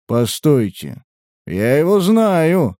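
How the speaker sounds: drawn-out bewildered realization; slow, confused. A narrator's reading. Comrade recognizing the prisoner, slow and confused. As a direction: say it slowly, thrown off balance. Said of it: "медленно произнёс сбитый с толку".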